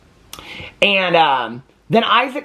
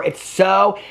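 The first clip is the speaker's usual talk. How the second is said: usual speaking voice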